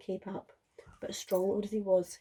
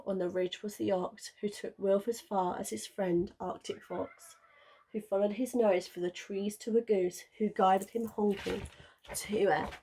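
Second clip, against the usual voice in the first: usual speaking voice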